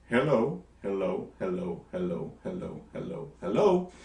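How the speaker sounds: repeated "hello", each stressed on the second syllable; steady da-DUM run of "hello", lifting the last; iambic beat. Shown as a demonstration. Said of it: "you've got an iambic pentameter".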